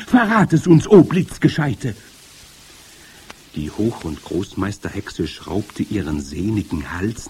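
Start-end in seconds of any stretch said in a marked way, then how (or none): 0.1-2.0 high, loud eager plea; excited crowd cry; calling out. A narrator's reading. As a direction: beg loudly as the witch audience. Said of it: "riefen sie".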